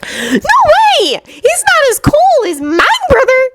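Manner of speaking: put-on very high little girl voice; squeaky small child insisting. A narrator's reading. Little Kirimi refusing, high child voice.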